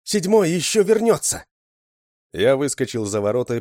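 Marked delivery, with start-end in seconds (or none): 0.0-1.5 high, loud insistent cry; woman shouting. A narrator's reading. Woman to soldiers about Seventh, high insistent shout. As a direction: insist loudly in a high female voice. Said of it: "закричала женщина солдатам".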